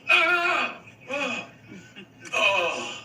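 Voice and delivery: high mock gasp that sinks away; tongue-tied panic. Bit player facing Harrison Ford forgets his line, mock panic. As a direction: imitate freezing and blanking on a line. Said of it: "Forgets his line".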